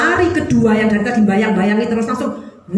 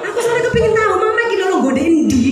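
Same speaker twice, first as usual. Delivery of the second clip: put-on higher, quicker, innocent child explaining; lightly voiced child; 8 semitones higher than usual. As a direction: play the kid making excuses.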